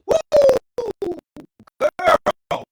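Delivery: very high, loud whoop of delight; excited cheer. Real, not acted. Cheering at a comment.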